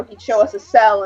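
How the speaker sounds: ordinary talk, nothing marked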